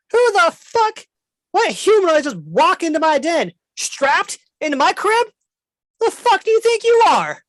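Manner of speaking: high, loud furious creature voice; outraged squawking dragon; angry dragon. Acted. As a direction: shriek at them to get out of his den. Dragon confronts intruders.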